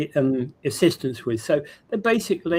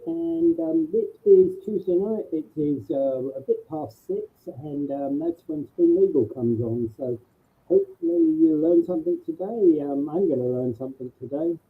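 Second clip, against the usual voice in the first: underwater; 2 semitones higher than usual. Real, not acted. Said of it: "underwater voice".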